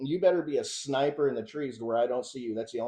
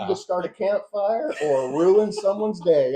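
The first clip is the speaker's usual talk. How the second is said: slow, loud, higher hammed-up Russian accent; mock Russian accent; 8 semitones higher, 9 dB louder than usual. Host riffing on the flamethrower shell, mock Russian accent. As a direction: deliver it like a deadpan Russian gun reviewer. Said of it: "he just acted like that accent".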